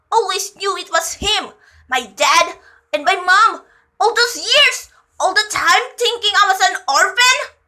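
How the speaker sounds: high, loud pained outburst; anguished child. A narrator's reading. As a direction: build into a high, loud cry of hurt. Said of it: "He sounded like a small child in pain".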